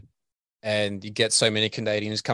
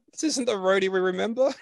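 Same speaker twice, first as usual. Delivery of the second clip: much higher-pitched mock audience voice; baffled crowd member; 10 semitones higher than usual. Singer imitating a let-down crowd, baffled high voice. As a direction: pipe up as a puzzled fan.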